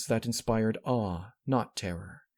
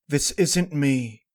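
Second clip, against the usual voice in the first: slow, high, loud disbelief; horrified denial; 6 semitones higher, 7 dB louder, slower than usual. Acted. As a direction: stress isnt, dragged out in horror. Horror at her reflection.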